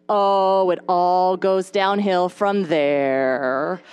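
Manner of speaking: imitating a discouraging older mom; Target lady. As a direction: stretch it out as a knowing warning. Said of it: "she says to me".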